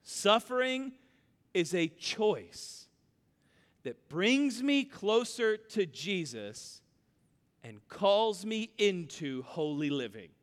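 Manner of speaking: slow, measured, one phrase at a time; dictated statement. Shown as a demonstration. Pastor dictating a theology sentence, slow and measured. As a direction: lay it out for note-taking. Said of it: "write this down".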